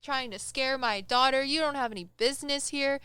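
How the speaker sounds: mom's loud, high telling-off, acted out; imitated yelling. Mom yells at a ghost over speakerphone, imitated yelling. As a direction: yell at an intruder like a protective mother. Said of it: "she starts just yelling at this ghost".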